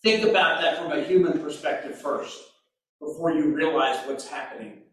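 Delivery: ordinary talk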